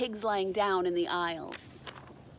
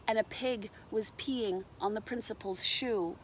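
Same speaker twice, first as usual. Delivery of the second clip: plain reading voice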